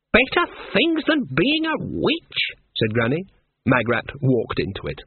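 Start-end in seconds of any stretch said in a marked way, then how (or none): 0.1-2.4 high, slow, silky trap; silky old witch. A narrator's reading. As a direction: lure her in, dangerously sweet. Granny sets a trap.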